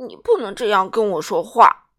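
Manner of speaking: loud, half-hearted sobbing protest; a sulky king sobbing his objection. A narrator's reading. King protests to Little Miss Sunshine, sobbing. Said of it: "国王抽泣着说".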